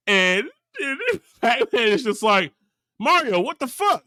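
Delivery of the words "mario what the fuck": high-pitched, incredulous retold outburst; mock outrage. Pac-Man confronting Mario at the pool, mock outrage. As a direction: imitate a stunned confrontation, pitched up. Acted shock at seeing wife there.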